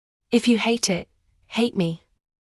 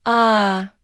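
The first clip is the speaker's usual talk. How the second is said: loud anguished scream; long pained scream; 4 dB louder than usual. Acted. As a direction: scream out in agony. Wife screams in pain.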